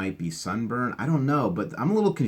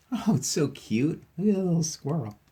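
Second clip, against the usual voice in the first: cooing over cute; 9 semitones higher than usual. Real, not acted. Cooing over squirrel picture.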